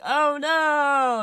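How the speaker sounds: loud, very high drawn-out wail; hammed-up damsel in panic; mock terrified cry. [name] facing the killer, mock-terrified wail.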